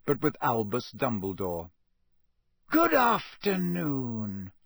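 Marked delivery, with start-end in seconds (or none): 2.7-4.5 slow, pleasant greeting. A narrator's reading. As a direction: greet them kindly. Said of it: "he said pleasantly".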